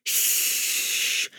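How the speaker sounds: long unvoiced hushing whoosh. A narrator's reading. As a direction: imitate a sudden rush of air. Imitates the sudden whoosh of bats. (táhlý svist)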